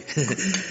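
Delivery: quick embarrassed little laugh; short laugh. Acted. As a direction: laugh it off, a bit caught out. Flattered ex-husband laughs. (petit rire gêné)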